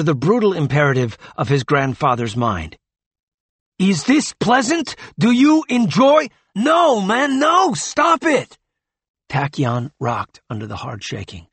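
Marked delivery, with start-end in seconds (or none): 3.8-6.3 loud, high-pitched furious questioning; enraged, voice pitched way up; raging taunt. A narrator's reading. Grandfather rages while forcing the boy. 6.5-8.6 very high, loud plea to stop; urgent, frantic shouting; desperate shout. A narrator's reading. Friend desperately intervenes to stop him.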